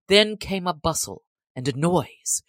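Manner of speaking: usual speaking voice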